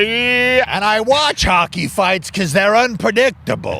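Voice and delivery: slow, high airy voice; mock new-age guy. New-age guy explaining his habits, slow high airy. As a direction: float it out serenely.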